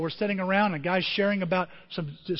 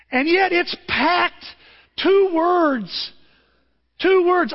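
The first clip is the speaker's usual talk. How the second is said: emphatic; 11 semitones higher, 7 dB louder, slower than usual. Acted. Preacher emphasizing shortest verse.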